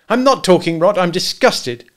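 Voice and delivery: high, loud, indignant retort; disgusted outburst. A narrator's reading. Husband to hunter at breakfast, disgusted. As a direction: snap back in disgust.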